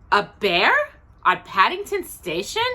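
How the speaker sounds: high, loud disbelieving question; amazed. Acted. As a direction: Mrs Brown can't believe it. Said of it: "looked at her husband in amazement".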